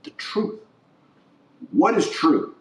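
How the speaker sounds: ordinary delivery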